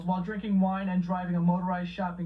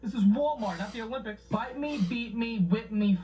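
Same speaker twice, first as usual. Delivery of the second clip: ordinary talk, nothing marked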